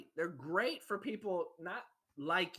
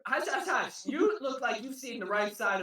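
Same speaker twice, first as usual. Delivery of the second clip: hushing; 4 semitones higher, 6 dB louder than usual. Acted. Character hushing.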